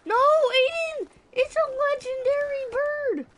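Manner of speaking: high, loud desperate yell; excited shout. Real, not acted. Gamer yelling at teammate Aiden about a legendary bird, frantic. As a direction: shout a warning at your teammate.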